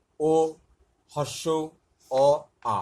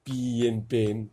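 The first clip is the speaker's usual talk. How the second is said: slow spelling out; much slower than usual. Shown as a demonstration. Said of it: "প এর মতো শোনায়".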